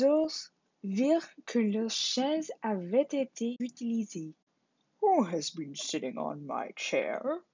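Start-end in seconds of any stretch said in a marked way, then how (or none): 5.0-7.4 lower, gruff-sounding papa bear voice; Father Bear. A narrator's reading. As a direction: ask it like a big father bear. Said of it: "asked Father Bear". (Papa Ours demande d'une voix plus grave)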